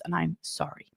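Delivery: soft, sincere sorry; mean every word of it, gently; heartfelt apology. Shown as a demonstration. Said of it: "a real apology sounds something like".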